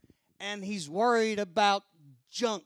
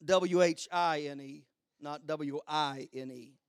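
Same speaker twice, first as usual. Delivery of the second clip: usual speaking voice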